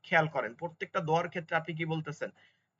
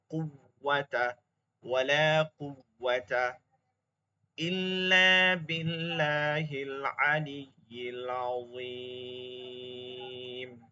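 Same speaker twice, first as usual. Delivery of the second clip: slow, careful recitation of the dua; 2 semitones lower, much slower than usual. Shown as a demonstration. Teacher reciting the repentance dua to the class. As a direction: recite it slowly and piously.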